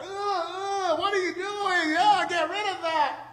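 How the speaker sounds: high, strained mock disgust with a whine; disgusted onlookers. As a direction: squeal at the smelly trash like a shocked shopper. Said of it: "people are walking like".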